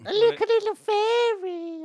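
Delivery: high, slow sing-song fairy voice. Retold or imitated. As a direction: float it up like a tiny fairy. Quoting a film character mockingly.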